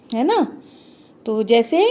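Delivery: ordinary talk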